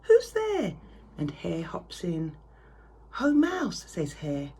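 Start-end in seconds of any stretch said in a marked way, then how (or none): 0.0-0.8 high loud squeaky mouse call; squeaky mouse cry. Acted. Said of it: "Mouse cries". 3.1-3.8 cheery hare. Acted. Hare greets mouse.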